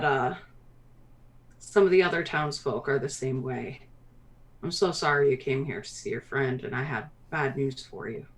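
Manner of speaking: sinking, low and soft; sorrowful hill dwarf mayor. Acted. Mayor apologizes to visitors for bad news, softly regretful. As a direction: let the voice fall as you apologize.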